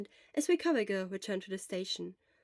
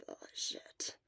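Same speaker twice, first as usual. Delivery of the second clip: hoarse sick whisper, barely any voice; Shota's voice; 10 dB quieter than usual. Acted. Shota whispering weakly to his friend from the sickbed. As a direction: whisper it like a sick man worn out.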